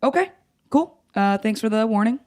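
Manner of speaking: high-pitched, halting politeness; awkwardly casual. Retold or imitated. Sister answers brother's grim phone call, awkwardly casual. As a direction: act out taking bad news far too lightly. Stunned polite reply to death news.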